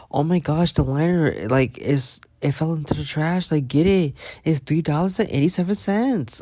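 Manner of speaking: high, fretting mock voice of a coworker; imitating fussy coworker. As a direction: pitch it up and fuss like Tina over pennies. Imitating Tina third time.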